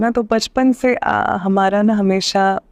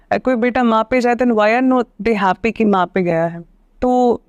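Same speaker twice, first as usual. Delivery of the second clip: ordinary talk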